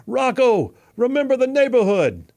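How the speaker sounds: loud, high yell across a boxing ring; imitating Paulie's urgent, high-pitched shout; ringside yell. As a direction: holler it to a fighter from ringside. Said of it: "yells from the ringside".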